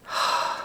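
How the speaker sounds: short soft sigh; quiet centering out-breath; demonstrated exhale. Shown as a demonstration. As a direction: breathe out softly to settle. Models breathing out in exercise.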